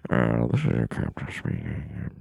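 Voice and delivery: low captain intercom voice; pilot announcement. Pretend. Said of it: "like I'm doing stand-up".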